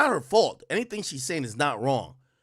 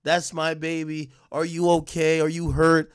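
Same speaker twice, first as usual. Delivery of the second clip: 1 nurturing mom; 4 semitones higher, 7 dB louder, slower than usual. Retold or imitated. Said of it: "Mom's supposed to be the very nurturing".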